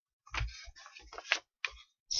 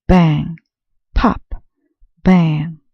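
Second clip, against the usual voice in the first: popping sounds; 5 dB louder, slower than usual. A narrator's reading. Balloons bursting in tree.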